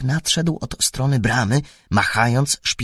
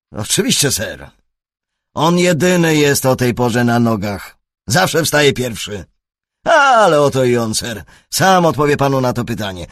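louder, higher servant voice; eager footman; 5 semitones higher, 7 dB louder than usual. A narrator's reading. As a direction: answer like a keen stable lad. Lokaj odpowiada Holmesowi. (gorliwy lokaj, głośniej i wyżej)

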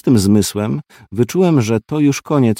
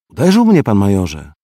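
z pretensją; 5 dB louder than usual. A narrator's reading. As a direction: complain like a wounded boy. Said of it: "odezwał się z pretensją w głosie". (z pretensją w głosie, wyżej i głośniej)

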